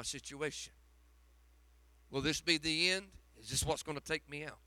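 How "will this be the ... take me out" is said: ordinary talk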